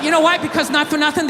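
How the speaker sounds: normal talking voice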